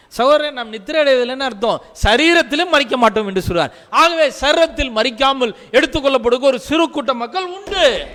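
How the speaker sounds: fervent sermon climax; impassioned preaching. Real, not acted. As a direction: build to a high, loud climax. Preacher builds to a proclamation.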